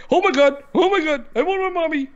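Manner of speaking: high-pitched whimpering mockery of a frightened gamer; mock scared whiner. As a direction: squeal it like a terrified kid, hammed up. Mocking a scared YouTuber.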